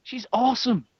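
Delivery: high, loud burst of delight; sudden excitement. Real, not acted. Gushing to co-hosts about a just-remembered Guinan, excited.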